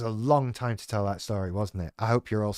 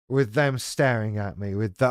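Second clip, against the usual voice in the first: ordinary delivery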